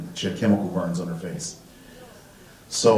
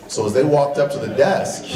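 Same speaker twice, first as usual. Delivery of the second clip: ordinary delivery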